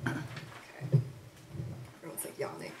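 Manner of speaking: breathy whisper off the mic; a real whispered aside, half to herself. Real, not acted. Poet whispering to someone nearby, an aside about the crowd.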